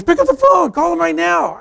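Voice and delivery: high-pitched, shouted command; urgent order. Retold or imitated. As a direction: order him loudly, voice leaping up. Friend ordering him, acted.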